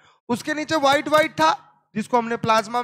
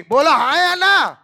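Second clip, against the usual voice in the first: loud call to class; 5 semitones higher, 6 dB louder than usual. Real, not acted. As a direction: shout it out to the whole class. Teacher calling class for answer. (कक्षा से ज़ोर से पूछना)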